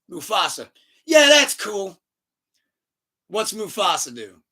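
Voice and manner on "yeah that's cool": high, loud acted reply; hammed-up easy promise; mock breezy agreement. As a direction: toss back an over-eager yes, loudly.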